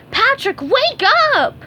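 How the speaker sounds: high, loud, bouncy wake-up call; gleeful call. A narrator's reading. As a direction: call out with bubbly glee. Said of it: "SpongeBob called as he bounced with glee".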